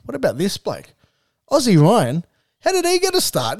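high, teasing mock surprise, pitch jumping up; mock disbelief. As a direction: pretend to be shocked he got picked. Teasing a mate on the team list.

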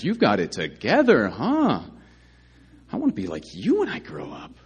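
slow, mocking overpraise; Paul mocking the proud Corinthians with sarcastic praise. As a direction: gush sarcastically over their greatness. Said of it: "Paul is being insanely sarcastic here".

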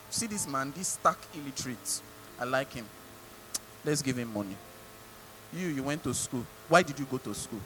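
lightly voiced God musing to himself; God deciding casually. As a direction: hand out fortunes offhand, low and quiet. Acts God casually picking who prospers.

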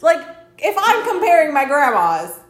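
high, loud laughing protest; mocking grandma's claim, high and laughing; laughing outrage. Real, not acted. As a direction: laugh in disbelief.

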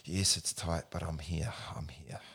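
fading to a soft whisper; calm self-talk. Shown as a demonstration. Coach showing calm self-talk in a stretch, fading whisper. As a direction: reassure yourself gently, trailing off. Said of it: "talking to yourself like that".

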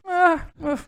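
dreamy, drawn-out absent reply; vague distracted nerve. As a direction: answer slowly, as if elsewhere. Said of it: "l'imitazione del nervo vago". (nervo vago svagato e lentissimo)

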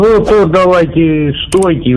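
ordinary talk

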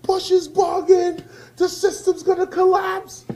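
high, loud mock rant; railing crowd. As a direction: shout it like an outraged crowd. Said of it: "Everybody railed".

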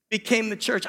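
normal talking voice

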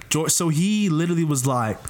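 normal talking voice

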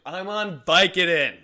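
slow, loud, proud announcement; loud slow declaration. Retold or imitated. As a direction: draw it out loud and high. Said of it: "And I'm like".

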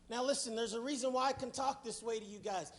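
ordinary delivery

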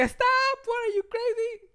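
high-pitched mock outburst; alarmed protest. Kid halting his friends' rock game, alarmed protest. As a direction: halt everyone with a high, incredulous cry.

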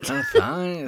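Sean Connery impression. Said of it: "it's very Sean Connery".

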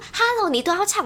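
a high, sarcastic Hello. Retold or imitated. To the guy who booked every chart hit, a high sarcastic Hello. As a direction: needle the song hog with a mock-incredulous Hello. Mocking a song-hogger.

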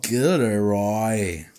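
slow, drawn-out name; mock-dramatic reveal. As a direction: stretch the name out grandly. Mock-dramatic reveal of the smiling man.

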